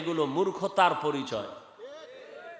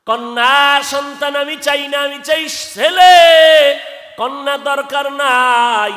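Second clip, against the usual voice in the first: high, loud demand with stretched endings; heated husband shouting; 11 semitones higher, 14 dB louder, slower than usual. Retold or imitated. Husband refusing another daughter, high angry shout. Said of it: "গরম হইয়া কয়".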